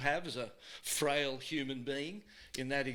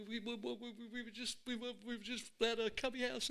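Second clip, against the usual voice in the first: scared stammer; 9 semitones higher, 4 dB quieter than usual. Retold or imitated. Kid stammering excuse to mum.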